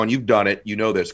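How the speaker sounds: normal talking voice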